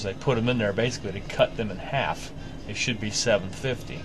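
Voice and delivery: ordinary talk, nothing marked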